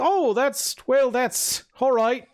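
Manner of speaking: high, hesitant and slowing; flustered Melran. Acted. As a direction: give in politely, pitched high. Melran reluctantly accepts being chained.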